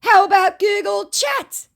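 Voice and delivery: high, loud, slow mock yelling. Woman mimicking the scammer's all-caps message, as a high mock yell. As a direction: shout it like a clueless scammer. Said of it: "I don't know why he was yelling".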